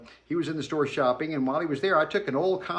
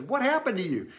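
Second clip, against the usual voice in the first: higher, startled dad voice; a hint of a horrified dad, pitched up; alarmed father; 8 semitones higher than usual. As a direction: ask like a shocked parent seeing blood. Dad voiced, horrified at bleeding son.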